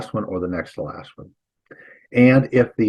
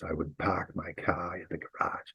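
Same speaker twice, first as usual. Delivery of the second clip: soft Massachusetts accent, dropped R's; 3 semitones lower, 15 dB quieter than usual. Shown as a demonstration. Teacher joking to students about being in Massachusetts, Massachusetts accent. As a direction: toss it off quietly in a Massachusetts accent. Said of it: "sitting right now in Massachusetts".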